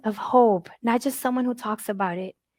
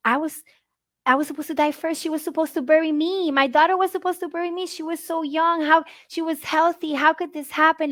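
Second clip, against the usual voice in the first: higher, pleading disbelief building up; rising grief; 5 semitones higher than usual. Retold or imitated. Mother retelling her thoughts after her daughter's death, rising grief. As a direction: let the ache climb as the questions come. Catching ourselves thinking.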